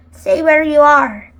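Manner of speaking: loud, firm shouted order; storybook shout, well above narration. A narrator's reading. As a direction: call out a warning. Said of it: "Jack shouted".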